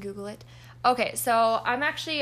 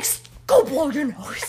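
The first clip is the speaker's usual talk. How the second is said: louder, slower annoyed order; 4 semitones higher, 7 dB louder, slower than usual. Retold or imitated. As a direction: snap an order impatiently. Telling classmate off.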